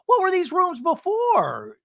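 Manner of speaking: high, slow imitated question; mock Shannon. Host mimicking Shannon on the house tour, high and slow. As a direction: ask it in a mocking high voice. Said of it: "And Shannon's like".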